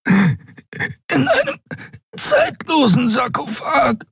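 high, slow, gasping fragments; thin, choked voice. A narrator's reading. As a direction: struggle for air between phrases, ending in a plea. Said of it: "Seine Stimme war dünn wie Nebel". (dünn und gewürgt, nach Luft ringend)

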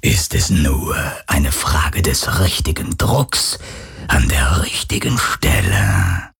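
"richtigen Stelle" breathed low and slow as a whisper; carefree phantom whisper. Acted. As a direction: savour the threat lightly like a ghost. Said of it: "sagte das Phantom unbekümmert".

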